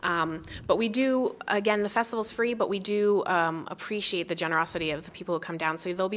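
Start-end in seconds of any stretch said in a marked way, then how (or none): none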